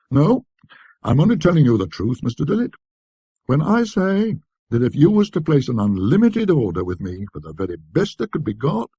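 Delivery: lightly voiced eager dealer; dealer's sales pitch. A narrator's reading. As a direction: lay it on like a shopkeeper selling hard.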